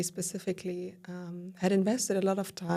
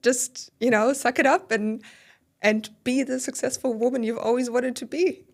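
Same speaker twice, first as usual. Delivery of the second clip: stern pep talk to yourself, higher and louder; pushy inner voice, raised and insistent; self-scolding; 5 semitones higher, 5 dB louder than usual. Retold or imitated. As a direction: order yourself to toughen up. Pushing herself in retold thoughts.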